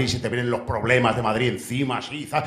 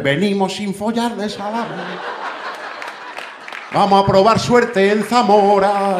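high, drawn-out group cry; acted carload of revellers, stretched and high; returning revellers' call; 8 semitones higher, 4 dB louder, slower than usual. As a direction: call it out high, swelling to loud. Acts carload coming back unlucky.

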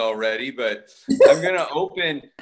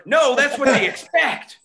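mock scheming spy; 9 semitones higher, 5 dB louder than usual. Pretzel spy imagined conversation.

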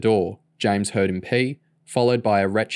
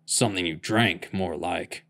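grumbled; 2 semitones lower, 4 dB quieter than usual. A narrator's reading. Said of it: "Bill grumbled".

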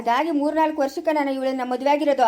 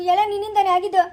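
higher, crying out the blame; tearful blame; 4 semitones higher, 3 dB louder than usual. Acted. Husband blaming the elder for his runaway wife, crying. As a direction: sob it out as an accusation.

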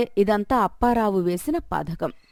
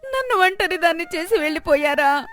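wailing widow; 7 semitones higher than usual. Acted. Widow wails over husband.

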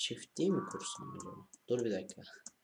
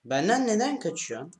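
whiny plea; 9 semitones higher, 7 dB louder than usual. Real, not acted. Pleading with fleeing cow.

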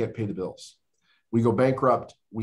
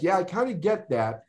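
wry sarcasm; 7 semitones higher than usual. Retold or imitated. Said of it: "And I'm like".

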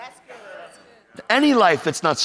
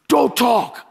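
high-pitched mock yell; sharp, raised command; retold screaming; 9 semitones higher than usual. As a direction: imitate a cop yelling an order. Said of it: "he's screaming at me".

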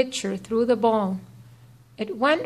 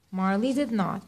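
ordinary delivery, nothing marked

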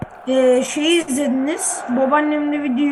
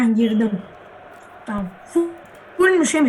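chewing gum; 7 semitones lower than usual. Real, not acted. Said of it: "şimdi sakız çiğniyorum".

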